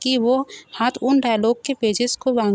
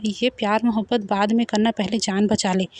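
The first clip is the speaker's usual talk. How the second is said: ordinary delivery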